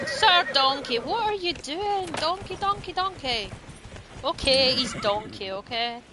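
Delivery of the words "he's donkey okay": ordinary delivery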